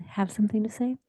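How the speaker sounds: whispered aside to a colleague; whispering. Real, not acted. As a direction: breathe the question, unvoiced. Quiet aside inviting Forrest.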